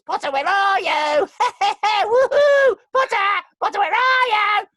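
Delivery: very high, loud, slow cackling ghost shout; Peeves the Ghost. Acted. Actor recreating the Peeves voice from the game, mad ghost shout. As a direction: taunt the boy like a gleeful poltergeist. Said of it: "I gave him that voice".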